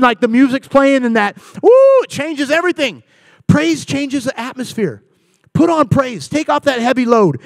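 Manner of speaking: ordinary talk, nothing marked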